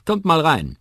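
laut und deutlich höher rufend; ruft. A narrator's reading. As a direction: freudig zur Tür hinaus rufen. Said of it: "ruft Molly McGovern". (lauter Ruf zur Begrüßung)

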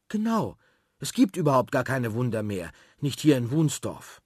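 put-on high, bright-eyed little girl voice, insistent; a girl backing up her friend, keen; eager girl. Sophie complains to the seller, high girl's voice. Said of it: "sagt Sophie".

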